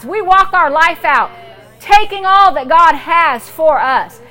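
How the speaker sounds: loud, slow commanding prayer; preaching at full force. Acted. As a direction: declare it like a preacher on fire.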